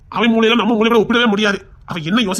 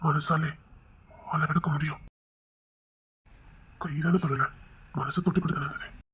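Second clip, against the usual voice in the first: tender hush; 6 semitones lower, 13 dB quieter, slower than usual. Acted. Husband softly wooing his wife.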